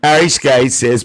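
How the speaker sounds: ordinary talk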